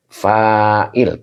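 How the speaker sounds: fa'il with a long drawn first a, very slow; slow, deliberate fa'il with long a; long vowel fa'il. Shown as a demonstration. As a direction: stretch the a in fa'il, it has an alif. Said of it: "panjang ya, ada alif ya".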